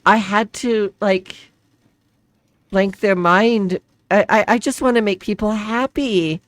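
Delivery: high, loud and slow, building to tears; distressed pleading. Acted. Woman breaking down before the hooded figure, crying. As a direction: pour it out until the voice cracks into crying. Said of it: "she just kinda breaks down and starts crying".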